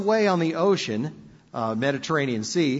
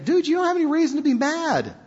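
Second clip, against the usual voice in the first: higher, fast, easygoing; casual God; 11 semitones higher, faster than usual. Retold or imitated. God telling Jonah to chill, higher and fast. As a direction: brush off the tantrum lightly. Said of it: "God saying".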